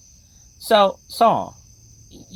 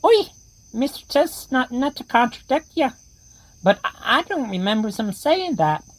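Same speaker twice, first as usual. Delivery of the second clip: put-on higher, childlike tin soldier; a tin soldier warning of a monster outside; toy soldier voice; 8 semitones higher than usual. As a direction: squeak it out like a frightened toy. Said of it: "the ones with the terrible accents".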